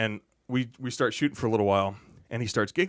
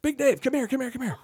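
eager calling out; 14 semitones higher than usual. Retold or imitated. Jeff calling him over.

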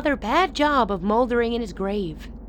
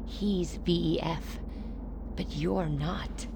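low, slow warning; 4 semitones lower, 10 dB quieter, slower than usual. Acted. Narrowed eyes, low pointed remark.

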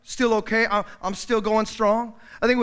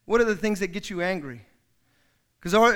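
ordinary talk, nothing marked

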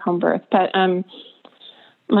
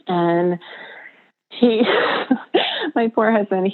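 normal talking voice

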